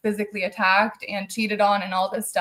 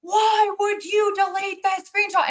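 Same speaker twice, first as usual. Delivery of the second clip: angry demand; 10 semitones higher than usual. Retold or imitated. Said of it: "He's like".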